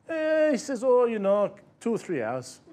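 put-on a touch of Mexican accent, easygoing. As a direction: lightly voice a relaxed Mexican fisherman. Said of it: "I apologise for the accent".

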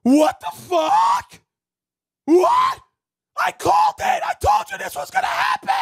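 rising, slow then screaming high and loud; retold panicked yelling. Streamer yelling at his friend's PC popping, screaming. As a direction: shriek in disbelief, building to a yell. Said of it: "And then I'm like".

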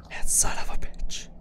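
hushed whisper curse. Real, not acted. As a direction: breathe the curse under your breath.

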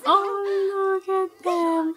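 high, soft, cooing voice. Real, not acted. Gushing over the Sims couple, high coo. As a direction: melt over them like they're babies.

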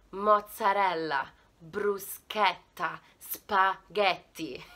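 slow, clear Italian words; slow drill. Shown as a demonstration. Teacher leads viewers in repeating slowly. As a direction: spell each word out slowly. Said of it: "try and say that with me".

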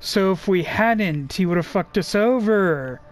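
ordinary talk

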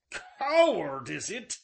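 loud, high burst on the first word, then falling; furious repeat. A narrator's reading. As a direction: explode on "Coward", then drop. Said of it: "he repeated".

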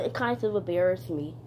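usual speaking voice

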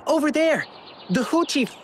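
alarmed call to the others. Acted. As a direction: point and shout it out. Spotting someone calling for help.

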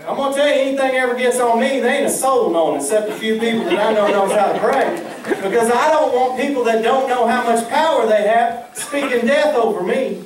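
high-pitched, worked-up preaching; riled up. Real, not acted. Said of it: "I'm a little riled up about it".

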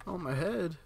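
normal talking voice

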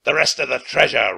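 loud roaring pirate. Acted. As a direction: roar it at the kids. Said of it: "roared Captain Bones".

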